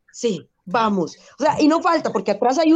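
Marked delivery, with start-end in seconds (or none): 0.1-1.2 mock gym bro. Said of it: "y usted los dos".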